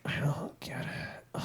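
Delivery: soft, high whisper playing dumb; whispered clueless reply. Imitating the soldier answering her superior. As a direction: breathe it out like she's lost.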